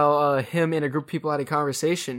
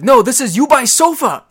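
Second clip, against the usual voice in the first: higher, louder goofy caller voice; mock prank caller; 8 semitones higher, 9 dB louder than usual. Friends joking about prank calling Ubisoft, goofy voice. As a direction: play a clueless caller who typed it wrong. Prank call mispronouncing company.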